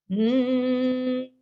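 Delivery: lips closed steady humming; steady hum. Shown as a demonstration. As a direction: hum on one note. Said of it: "exhale to a humming sound".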